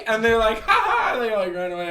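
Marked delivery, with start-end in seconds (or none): 0.6-1.1 high, loud mock giggle; mimicked kids' laugh. Little kids laughing as they run away, high laugh. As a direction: imitate kids' teasing laughter.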